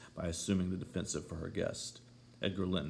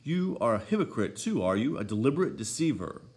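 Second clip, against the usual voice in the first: high, loud, outraged outburst; indignant accusation; 4 semitones higher, 9 dB louder than usual. A narrator's reading. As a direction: accuse them sharply, voice raised. Accusing someone of deceit.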